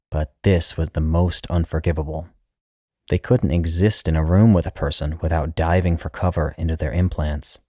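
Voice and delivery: usual speaking voice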